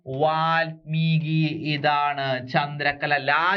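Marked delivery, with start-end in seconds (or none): 0.1-1.7 വാൽമീകി stretched out very slowly with a lower tone; very slow spelling-out. Shown as a demonstration. Teacher showing how the word is written. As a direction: draw out വാൽമീകി syllable by syllable, very slowly. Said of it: "എങ്ങനെയാണ്?".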